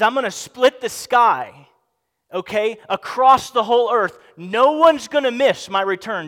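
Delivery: loud, high, ringing preacher's declaration; forceful proclamation. Retold or imitated. Preacher quotes Jesus promising his return, forceful proclamation.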